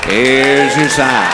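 low, slow deadpan; slow low deadpan tag line; catchphrase punchline. Acted. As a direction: draw it out low and dry.

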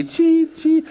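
high, sharp electronic chirps; imitated scanner chirp. Pastor imitating the airline check for his congregation, high scanner chirps. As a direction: mimic a checkpoint scanner going off.

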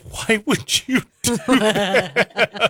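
slow, drawn-out teary whine; mock crying plea. Brother retelling his crying sister asking why, drawn-out teary whine. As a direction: plead like a sister on the verge of tears. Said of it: "she starts to cry and she goes".

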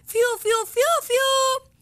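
high voiced imitation of whistled notes; whistle-like calling. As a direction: call "fiu" like a mother summoning her kid. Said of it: "pero me gritaba mi mamá". (chiflido de mamá imitado, agudo)

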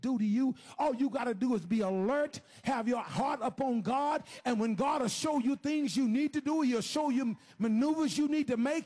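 building sermon drive; preacher rising into a shout; full-force preaching. Real, not acted. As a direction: build phrase on phrase, rousing the church.

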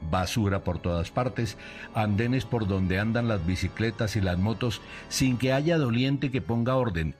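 ordinary talk

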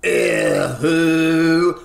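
a loud, odd yelp as he's zapped; store manager's cry. Acted. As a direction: howl as the laser hits. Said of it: "went the store manager".

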